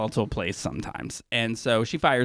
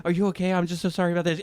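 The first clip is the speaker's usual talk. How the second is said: higher imitated fussing apology; mock concerned apology; 4 semitones higher, much faster than usual. Fired man checking on Jen, mock apologetic. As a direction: switch to sweet concern, slightly hammed-up.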